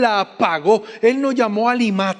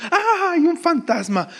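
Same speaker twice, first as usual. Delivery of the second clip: high-pitched imitation of a scared yelp; mock frightened cry; 10 semitones higher than usual. Disciples see a ghost on the lake, mock frightened cry. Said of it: "entonces gritaron de miedo".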